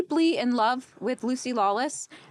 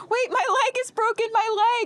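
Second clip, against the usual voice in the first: high-pitched imitation of tearful pleading; mock crying; 11 semitones higher than usual. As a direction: wail it like a crying man, hammed-up. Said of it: "he's crying and he goes like".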